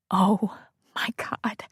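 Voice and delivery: slow breathless whisper; stunned wife breathing out her shock. Acted. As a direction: barely breathe the words. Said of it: "she said in a breath".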